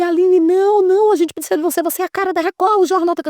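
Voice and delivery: pleading boss. Retold or imitated. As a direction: beg her not to leave, quickly at first. Boss begging her to stay.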